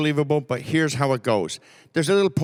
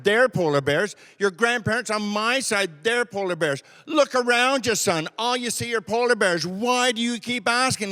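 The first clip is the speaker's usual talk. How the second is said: fed-up dad; 6 semitones higher than usual. Retold or imitated. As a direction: spell it out like a father at his wit's end. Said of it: "the father sitting there just literally goes".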